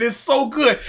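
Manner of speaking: normal talking voice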